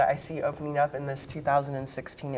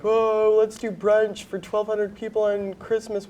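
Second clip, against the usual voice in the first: bright, raised, breezy sarcasm; mock cheery boss; 5 semitones higher than usual. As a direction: toss it off like a clueless manager. Mocking hotel bosses' cheery plan.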